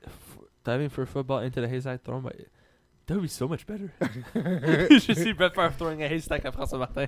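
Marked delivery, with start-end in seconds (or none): 3.1-7.1 laughing through the words; can't stop laughing while talking. Real, not acted. Laughing at the haystack image.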